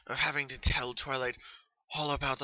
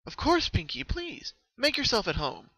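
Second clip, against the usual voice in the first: high, louder hurried welcome; quick welcome; 11 semitones higher, 5 dB louder than usual. Acted. Twilight hurrying to welcome Pinkie inside, quick high voice. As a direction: rush to make her feel at home. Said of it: "Twilight said quickly".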